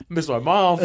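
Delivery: mock tearful whine with a laugh in it; mock whining. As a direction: whine it like a homesick tough guy, half laughing. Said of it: "like, whining".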